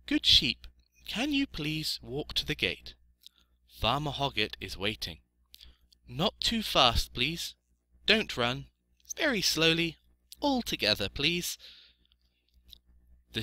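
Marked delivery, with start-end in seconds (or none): 0.0-11.6 high, polite piglet voice; quiet-voiced piglet. A narrator's reading. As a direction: coax gently as a small pig. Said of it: "In a quiet voice he said".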